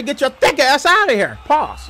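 ordinary talk

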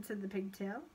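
ordinary delivery

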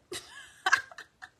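loud squealing laugh; high burst of laughter. Real, not acted. Laughs after spitting.

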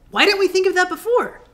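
high excited scarecrow voice; excited cry. Acted. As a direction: cry out with sudden delight. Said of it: "cried the Scarecrow".

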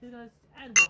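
normal talking voice

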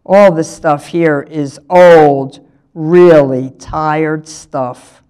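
slow, loud, weighted complaint; scornful pupil. A narrator's reading. Tofu grumbling about the museum exhibits, slow and scornful. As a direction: drag out each word with dismissive weight.

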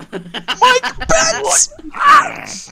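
very high, loud shout with a laugh; excited shriek. Real, not acted. Reading the story to friends, excited shriek of the name. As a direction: blurt the name out in delight.

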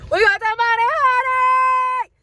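very loud, high-pitched gleeful shriek; all-out jubilant yell, far above normal pitch; celebratory scream. Real, not acted. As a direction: scream it out in triumph. Celebrating, escalating the repeated brag.

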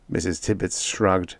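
plain reading voice

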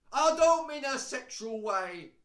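sudden high, very loud yell; shouted disclaimer. Pretend. Clarifying to viewers about attraction, sudden loud shout. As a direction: blurt it out slowly at full volume.

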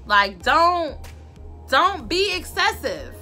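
normal talking voice

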